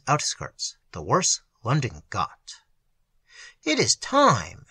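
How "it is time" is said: slow and higher, the moment has come; urgent old magician. A narrator's reading. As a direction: announce it, slowly and high. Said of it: "said the old man".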